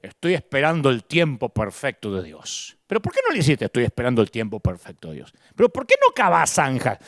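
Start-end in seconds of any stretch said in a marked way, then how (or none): 0.0-2.8 low, mocking voice of a passive believer. Preacher mocking believers who wait on God, lowered mock voice. 3.7-5.3 low, quick mocking mimicry; mocking excuse. Preacher repeating the excuse in a low, quick mock voice.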